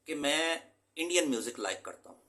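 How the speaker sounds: ordinary talk, nothing marked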